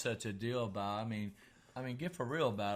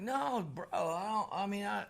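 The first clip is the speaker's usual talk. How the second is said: protesting; 9 semitones higher than usual. Retold or imitated. Retelling his protest to Englishman.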